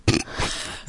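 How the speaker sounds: low-volume pointed throat clear; imitated throat clearing. Coach imitating a fellow coach's reaction, quiet throat clearing. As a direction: clear the throat like a watching colleague. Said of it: "one of the coaches to the left of me go".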